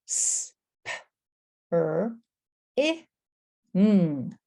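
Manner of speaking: "S, p, er, I, ng" one sound at a time; five separate sounds with pauses, "S, p, er, I, ng"; hissed "S", popped "p", "er", short "I", held "ng". Shown as a demonstration. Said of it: "how many individual sounds".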